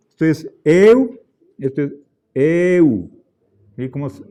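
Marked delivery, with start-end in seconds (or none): 0.6-1.2 long E gliding into u, louder; lengthened eu. Shown as a demonstration. Teacher shows the optional long eta, drawn-out eu. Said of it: "si desean pueden alargar". 2.3-3.1 eu with a drawn-out E; lengthened eu. Shown as a demonstration. Teacher shows the long diphthong to students, drawn-out eu. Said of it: "si desean pueden alargar".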